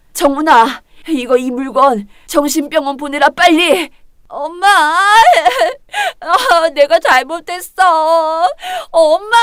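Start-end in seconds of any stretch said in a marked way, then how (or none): 4.7-6.1 loud, high crying wail; a daughter wailing at her mother's feet; wailing sob. Acted. 6.2-9.4 slow, crying apology; pleading tearfully with her mother; sobbing plea. Acted. Daughter begs forgiveness.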